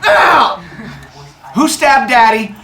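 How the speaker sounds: high, loud mock yell of pain; hurt outraged dad. Father Sky stabbed, high loud mock outrage. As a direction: yelp then demand who did it. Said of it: "He's like".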